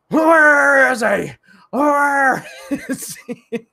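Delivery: slow, high mock vigilante yell breaking into laughter; Bale Batman voice. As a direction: demand it like Batman interrogating, cracking up. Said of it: "doing that Bale Batman voice".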